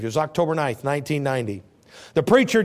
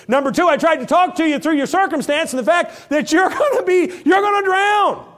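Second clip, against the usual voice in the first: higher and louder toward a shout on drown; rising warning shout; 8 semitones higher, 4 dB louder than usual. Acted. God to Jonah, building to a warning shout.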